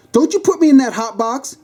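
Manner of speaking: high-pitched indignant yell; high, frantic protest. Acted. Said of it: "The lady yelled".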